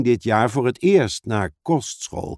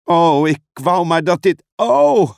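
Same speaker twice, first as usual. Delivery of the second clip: loud wail rising much higher; distressed cry; 5 semitones higher, 5 dB louder than usual. Acted. Suze being swept away by magic, crying out in distress. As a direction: wish it over, then cry out.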